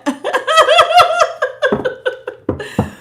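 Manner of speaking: long high cackling laugh; high-pitched giggling fit trailing off; burst of laughter. Real, not acted. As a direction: crack up, high and helpless. Laughing at her own remark.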